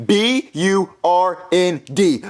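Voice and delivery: usual speaking voice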